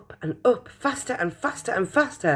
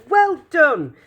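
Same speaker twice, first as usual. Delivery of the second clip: hearty praise; 10 semitones higher, 7 dB louder than usual. Acted. Praising Charlie again.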